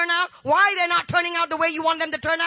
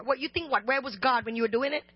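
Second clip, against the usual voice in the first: ordinary talk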